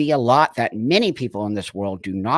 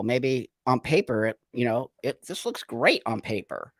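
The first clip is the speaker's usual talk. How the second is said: usual speaking voice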